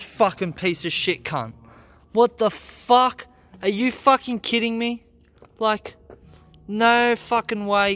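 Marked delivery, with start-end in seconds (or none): 2.1-8.0 loud, high furious yelling; real furious outburst. Real, not acted. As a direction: explode at the broken part. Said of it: "I'm so fucking pissed off".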